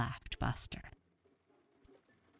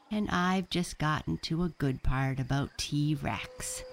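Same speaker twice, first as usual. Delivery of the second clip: ordinary delivery, nothing marked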